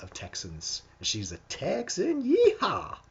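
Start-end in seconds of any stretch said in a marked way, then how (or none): 1.5-3.0 high whoop rising to a loud yeehaw; playful Texan yeehaw. Pretend. Host celebrates her being a Texan, playful whoop. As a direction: holler a hammed-up Texan cheer.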